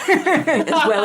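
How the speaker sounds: laughing, slow and high. Real, not acted. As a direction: laugh through as well as.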